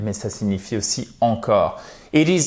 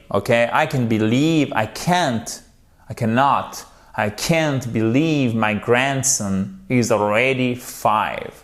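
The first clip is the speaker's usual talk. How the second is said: ordinary delivery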